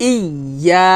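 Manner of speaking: ইয়া with a held, doubled y; tongue to palate. Shown as a demonstration. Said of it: "এভাবে উচ্চারণ করতে হবে".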